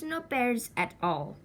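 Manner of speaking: usual speaking voice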